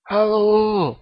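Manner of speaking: a kid's hammed-up Scottish accent; Billy Connolly impression. Guest Billy Connolly greets the podcast host, mock Scottish accent. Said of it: "Billy Connolly is on the show".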